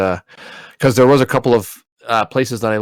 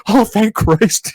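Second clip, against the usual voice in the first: slow, high, breathy relief; relieved sigh; 12 semitones higher, 4 dB louder, much slower than usual. Retold or imitated. Father finding his baby safe after the nightmare, slow relief. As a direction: let the relief pour out slowly.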